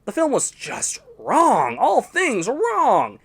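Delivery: very slow, drawn-out; slow dragged-out disgust. Acted. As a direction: drag every word out in disgust. Ranting about the Mario movie.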